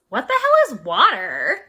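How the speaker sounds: a clueless little fish, honestly confused; baffled young fish. Acted. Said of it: "one turns to the other and goes".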